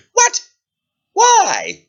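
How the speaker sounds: high, loud disbelief; shocked princess. Acted. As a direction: squawk of royal dismay.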